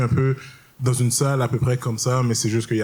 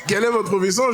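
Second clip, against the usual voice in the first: higher, prim judge voice; imitating the judge; 8 semitones higher, faster than usual. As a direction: ask it like a stiff court official, pitched up.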